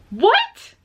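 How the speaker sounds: high, loud startled what; stunned by a voice; shocked what. Real, not acted. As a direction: blurt it out in disbelief. Reacting to the singer's voice.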